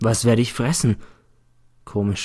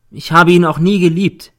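put-on girl insisting louder to the court; a bit louder; 7 semitones higher, 7 dB louder than usual. A narrator's reading. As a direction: raise the voice a little, firm. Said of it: "sagt sie etwas lauter".

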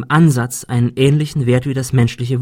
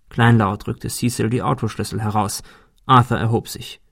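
ordinary delivery, nothing marked

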